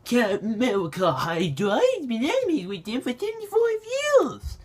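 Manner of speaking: high-pitched, mocking whine; squeaky mock indignation; whiny Twitter bandwagoner. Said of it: "people on Twitter right now are bandwagoning".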